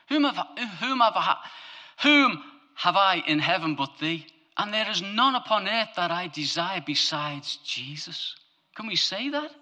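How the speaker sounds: high, loud preaching build; full-force sermon voice; impassioned preaching. Acted. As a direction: preach it out with fire.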